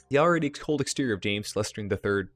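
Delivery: ordinary talk, nothing marked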